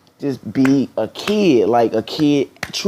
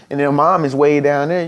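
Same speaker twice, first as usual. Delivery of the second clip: normal talking voice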